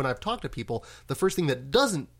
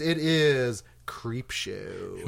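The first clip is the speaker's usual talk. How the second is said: dramatic announcer; 2 semitones lower, much slower than usual. Acted. Dramatic reveal of the featured film.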